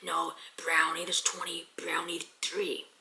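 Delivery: whispered imitation of a pushy seller; imitating him muttering the count; hushed mock insistence. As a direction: insist under your breath like him. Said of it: "it's like".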